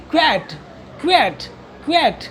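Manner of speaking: क्वैट with an open e, "kvet"; model pronunciation. Shown as a demonstration. Teacher drills how the word can be said, model pronunciation. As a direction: repeat क्वैट as a crisp "kvet", pausing between takes. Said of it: "तो इस तरीके से क्वैट बोल सकते हैं".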